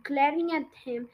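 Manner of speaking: usual speaking voice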